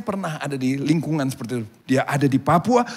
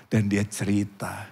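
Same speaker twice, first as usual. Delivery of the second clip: ordinary delivery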